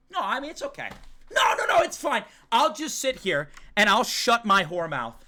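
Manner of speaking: high-pitched, put-upon outburst; mock hurt protest. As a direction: play the martyr, voice climbing high. Mock tantrum at chat.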